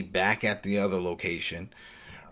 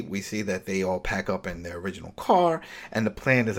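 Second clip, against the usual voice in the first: ordinary talk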